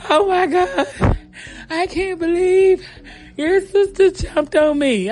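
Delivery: high, drawn-out wail of a distressed mom; hammed-up tearful high voice; mock hysterical crying. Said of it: "She crying hysterically".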